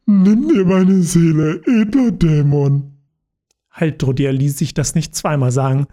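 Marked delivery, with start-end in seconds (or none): 0.0-2.9 put-on slow, loud drunken slur; drunk slurring.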